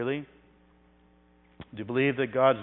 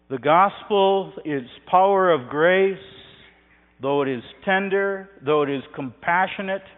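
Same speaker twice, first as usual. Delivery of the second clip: loud, raised, insistent preaching; fervent sermon climax, voice lifted high; preaching at full force; 5 semitones higher, 9 dB louder than usual. Acted. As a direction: thunder it out from the pulpit like a lion's roar. Preaching climax on sovereign grace.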